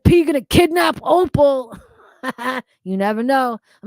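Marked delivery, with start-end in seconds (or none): 0.0-1.8 high, loud playful yell; mock shout. Streamer reading a viewer's kidnap joke, shouted playfully. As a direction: belt out the joke threat.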